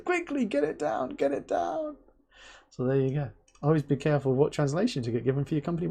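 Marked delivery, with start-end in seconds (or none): 0.0-2.0 imitated panicked urging. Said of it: "And he goes".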